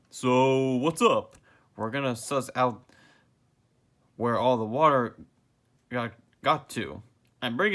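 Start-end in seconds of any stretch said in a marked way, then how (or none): 0.1-1.4 louder, slow. Acted. Same question said twice.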